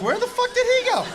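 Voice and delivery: high-pitched mock bewilderment; baffled outburst. Onlooker after his buddy disappeared, high baffled retelling. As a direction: shoot the voice up in disbelief. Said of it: "I was like".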